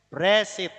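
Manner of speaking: Bresit in two syllables, b and r joined; correct pronunciation. Shown as a demonstration. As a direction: model "Bresit" as bre-sit, falling. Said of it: "bunyinya bukan beresit, tapi". (Bresit diucapkan rapat tanpa e)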